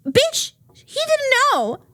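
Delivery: high, slow, loud cry of outrage; exasperated outburst. Real, not acted. Viewer yelling at the mom on screen, outraged wail. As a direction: wail it like you can't believe her. Angry rant at mom character.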